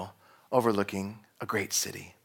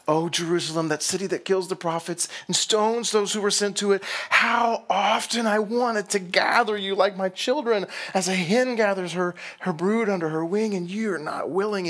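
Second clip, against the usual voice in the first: high, impassioned lament; acted wailing; 9 semitones higher, 4 dB louder than usual. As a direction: plead in grief, high-pitched and building louder, then easing. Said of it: "and wailing".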